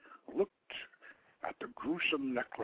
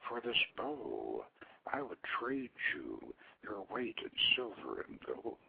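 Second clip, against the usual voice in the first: seductive whisper; 3 semitones lower, 10 dB quieter than usual.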